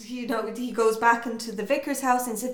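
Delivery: normal talking voice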